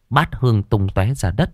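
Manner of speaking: ordinary delivery, nothing marked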